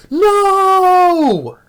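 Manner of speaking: high, loud, drawn-out cry of "No!"; anguished outburst. Retold or imitated. Vlogger mimicking Seagal's grief scene, a loud drawn-out wail. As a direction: howl "No!" like he just lost his wife. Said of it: "bursting out".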